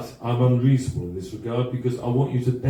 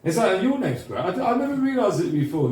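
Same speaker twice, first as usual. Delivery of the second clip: ordinary delivery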